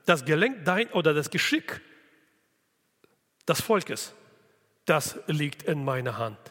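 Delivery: ordinary talk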